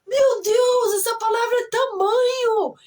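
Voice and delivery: high, loud astonished exclamation; shocked realization. Retold or imitated. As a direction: shriek it like a sudden discovery. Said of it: "Fazia cinco anos que eu falava". (exclamação alta e aguda de espanto)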